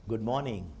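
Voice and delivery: गुड morning said softly and flat; low-energy greeting. Shown as a demonstration. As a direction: say it with no enthusiasm at all. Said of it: "अभी मैं यहाँ से ऐसा बोला".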